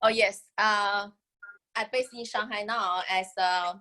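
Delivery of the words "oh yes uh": normal talking voice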